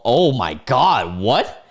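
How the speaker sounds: slow, high exclamation of shock; shocked disbelief. Real, not acted. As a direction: draw it out, aghast. Shocked reaction to the reply.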